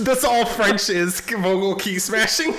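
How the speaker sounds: laughing through the words, high and slow; giggling out the joke. Real, not acted. Laughing while joking about French.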